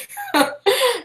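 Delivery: a short, high, flattered giggle. Real, not acted. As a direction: giggle it off, embarrassed.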